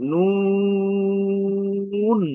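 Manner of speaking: নুন with a very long, loud, steady oo; drawn-out letter name. Shown as a demonstration. Teacher models the letter's name, long-drawn. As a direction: hold the oo of নুন high and even. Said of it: "আমরা এটাকে দীর্ঘ টান দিতে পারি".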